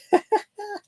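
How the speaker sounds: a squeaky high laugh; high laughter. Real, not acted. Laughs after his impression.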